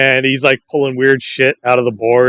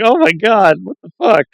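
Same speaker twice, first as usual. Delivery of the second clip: mock shock; 8 semitones higher than usual. Retelling sketch, crunching glass.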